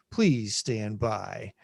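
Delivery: slow low please stand by; drawn-out mock broadcast announcement; announcer voice. Repeated announcer filler.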